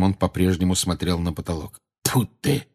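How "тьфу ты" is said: mild, irritated curse. A narrator's reading. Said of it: "выругался он".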